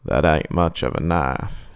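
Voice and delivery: a creepy clown croaks at her knife; croaking clown. Acted. Low slow clown croak. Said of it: "he croaked".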